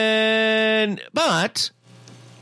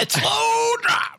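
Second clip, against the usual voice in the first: drawn-out, much higher shout; slow mock shout; slower than usual. Real, not acted. Co-host mocking the movie's slow build with a slow high shout.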